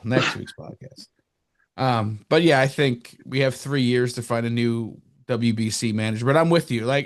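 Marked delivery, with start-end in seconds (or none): 0.0-1.1 hushed echo of the words under the breath; whispered aside. Shown as a demonstration. Cohost whispering a repeated joke to Paul, hushed aside. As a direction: whisper the podcast line again like a private joke to yourself.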